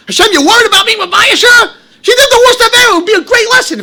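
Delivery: high, loud, crying protest at Hashem; mock outrage. Preacher acting out outrage at Hashem's mercy, loud crying protest. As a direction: burst out in hammed-up indignation.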